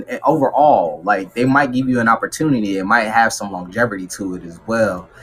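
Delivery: ordinary talk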